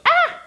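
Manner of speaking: very high, loud frightened yelp; scared yelp. Retold or imitated. Villain shrieking in fright as Inigo faces him. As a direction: squeal like a coward fleeing. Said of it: "the six-fingered man goes".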